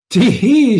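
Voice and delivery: high giggling tee hee. Acted. Allison giggling at Absalom, high tee hee. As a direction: giggle it mockingly. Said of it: "she said".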